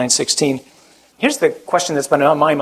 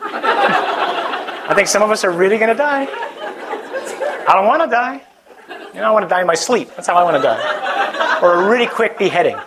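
high, laughing through the words; 5 semitones higher than usual. Real, not acted. Joking to the youth about dying, laughing. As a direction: chuckle through the joke.

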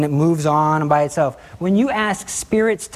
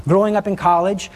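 ordinary talk

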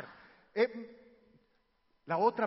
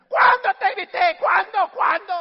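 very loud, high shouted denial; yelling parent; 10 semitones higher, 18 dB louder than usual. Acted. Parent denying ever yelling at child, furious shout. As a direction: bellow the question over and over. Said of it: "todo el tiempo me está gritando".

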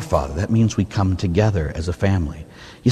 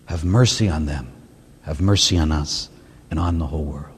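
soft, lower, unhurried prayer; quiet prayer; 6 semitones lower, 3 dB quieter than usual. Acted. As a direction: pray it gently over them. Prays the line over people.

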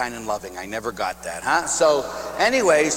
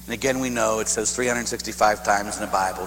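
usual speaking voice

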